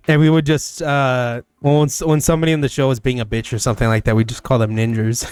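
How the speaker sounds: ordinary delivery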